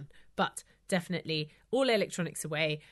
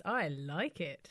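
ordinary talk, nothing marked

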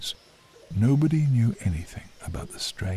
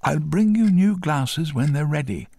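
higher, louder voice for the optician; raised, projected character voice; 8 semitones higher, 7 dB louder than usual. A narrator's reading. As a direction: pitch it up and project to the customer. Optician addressing her customer.

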